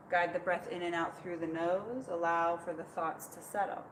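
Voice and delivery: soft, calm meditative guidance; serene tai chi cues. Acted. Leads tai chi practice.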